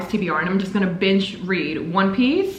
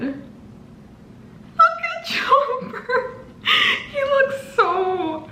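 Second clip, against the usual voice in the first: very high, stretched-out squeal breaking into laughter; dramatic, tearful gushing; much slower than usual. Real, not acted. Fan melting over Chopper, dramatically squealing. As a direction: squeal it slowly, nearly crying with joy. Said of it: "I'm being so dramatic".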